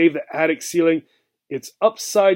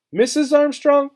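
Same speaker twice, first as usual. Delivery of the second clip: much higher, disbelieving question; can't believe it, ask it high; incredulous; 10 semitones higher than usual. A narrator's reading. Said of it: "said Obie incredulously".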